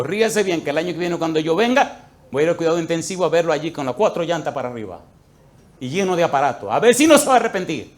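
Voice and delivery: loud, rising threat at a mocking man; angry warning. Pastor warns the mocking husband, loud angry threat. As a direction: warn him he'll end up in intensive care, shouting.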